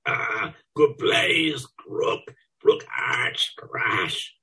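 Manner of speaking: hoarse sweet moaning. A narrator's reading. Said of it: "sweetly, hoarsely".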